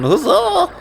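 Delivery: very high squeaky cartoon cry; Chip. Mimicking a sound effect from the game, high squeaky cheer. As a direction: imitate a little game character's squeal. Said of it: "You can still hear Chip going".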